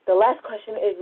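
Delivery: normal talking voice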